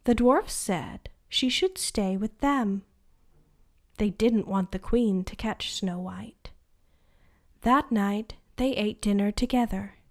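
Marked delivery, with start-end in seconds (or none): none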